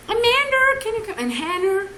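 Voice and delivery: very high put-on calling voice; imitated name-calling. Imitating someone summoning Amanda and Hannah, high-pitched call. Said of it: "I've heard Amander".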